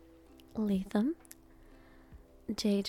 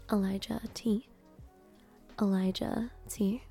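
normal talking voice

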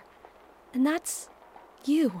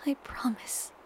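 softer whisper, falling at the end; 4 semitones higher, 7 dB quieter than usual. A narrator's reading. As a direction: whisper it a bit fuller. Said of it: "I whisper into the crook".

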